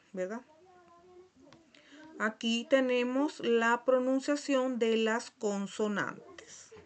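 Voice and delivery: normal talking voice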